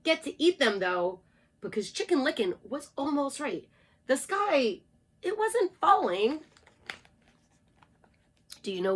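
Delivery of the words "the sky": usual speaking voice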